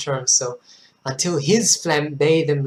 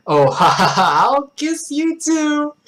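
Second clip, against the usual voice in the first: high, slow, mock-giggly reply; exaggerated coy laughter; hammed-up laughing flirtation; 11 semitones higher, 4 dB louder, slower than usual. Acting awkward flirty character with laugh.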